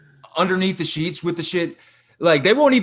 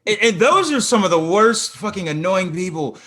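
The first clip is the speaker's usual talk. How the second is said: ordinary talk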